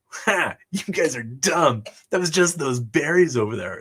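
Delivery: teasing a fooled bully; mocking taunt. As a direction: rub it in, playground mockery. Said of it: "I say".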